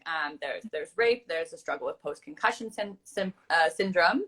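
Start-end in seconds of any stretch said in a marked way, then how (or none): 2.7-4.2 tripping over syndrome; false starts before getting the word out; stuttering. Real, not acted. Interviewer stumbles over post-concussion syndrome, stuttering. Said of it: "I'm stuttering".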